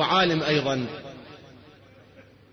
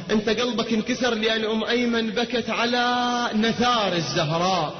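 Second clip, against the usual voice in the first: higher, fervent sermon storytelling; impassioned preaching; 4 semitones higher than usual. Acted. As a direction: preach the story with rising fervour. Lamenting address to the Prophet. (خطابة حماسية بصوت مرتفع)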